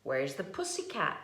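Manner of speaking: usual speaking voice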